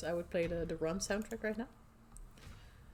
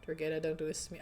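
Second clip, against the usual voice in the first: normal talking voice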